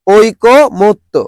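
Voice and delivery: ঐকমত্য drawn out as oi... ko... mot... to; very slow ঐকমত্য, single k, gaps between syllables; syllable-by-syllable pronunciation. Shown as a demonstration. Teacher drilling the answer again, ঐকমত্য in slow syllables. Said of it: "কি হবে".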